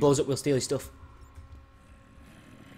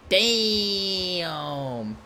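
one long falling wail; long-drawn damn; 3 semitones higher than usual. Real, not acted. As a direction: stretch the word out, sliding down. Exaggerated long exclamation.

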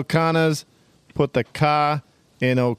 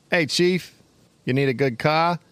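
put-on Boston accent. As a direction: fake Boston car-ad pitch. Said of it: "Irish mixed in with the Boston accent".

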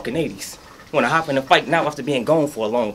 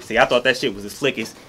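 ordinary talk

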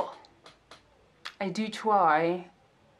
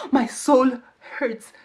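mock anguish; 7 semitones higher, 6 dB louder, slower than usual. Complaining about painful shoes.

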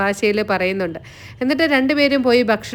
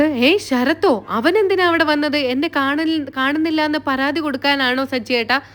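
wife stunned to hear her brother went to the police, higher and amazed; surprised; 5 semitones higher than usual. Retold or imitated. Revathi hears her brother went.

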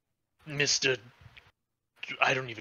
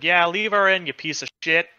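loud, higher heckling yell; 6 semitones higher, 8 dB louder than usual. Retold or imitated. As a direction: shout the insult across the room. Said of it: "Amico yells out".

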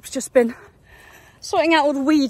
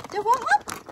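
high coaxing offer; 10 semitones higher than usual. Real, not acted. Offering treat again, higher.

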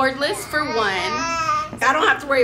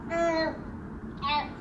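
ordinary talk

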